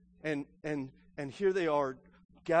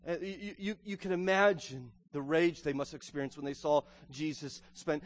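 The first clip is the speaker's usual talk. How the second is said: ordinary talk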